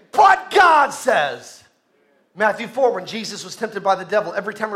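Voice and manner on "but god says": loud, very slow proclamation; bold declaration. Acted. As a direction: declare it slowly and forcefully, like a preacher. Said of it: "He began to say".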